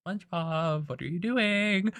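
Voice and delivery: petrified, soft, high-pitched aside; Mister Krabs. Acted. Boss petrified beside his employee, high hushed questioning. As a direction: squeak it quietly, drawing out the words. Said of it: "petrified right next to him".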